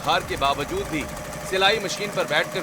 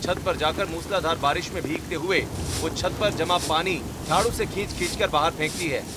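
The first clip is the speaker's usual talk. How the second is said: story narration; 3 dB louder than usual. A narrator's reading. Narrator describing the sister's night on the roof, storytelling. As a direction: narrate the scene calmly.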